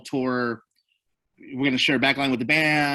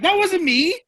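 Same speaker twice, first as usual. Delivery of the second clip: much higher and louder, in alarm; alarmed protest; 5 dB louder, slower than usual. Retold or imitated. Driver denying he caused the bang, alarmed. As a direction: blurt it out in panic.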